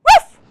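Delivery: one high, loud woof; single sharp puppy yip. Acted. Biscuit answering the reader, one high loud woof. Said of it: "And what does he say?".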